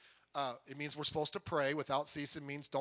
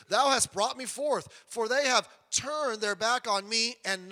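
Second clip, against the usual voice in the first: scripture reading; 3 semitones higher, 4 dB louder than usual. Real, not acted. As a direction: declaim it like a prophet. Reading prophet's quotation aloud.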